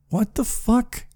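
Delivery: high, squeaky disbelief; incredulous. Pretend. Listener baffled by his confession, high incredulous squeak.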